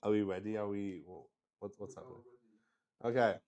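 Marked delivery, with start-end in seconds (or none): none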